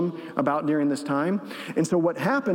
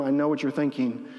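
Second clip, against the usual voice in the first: normal talking voice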